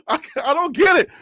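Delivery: ordinary delivery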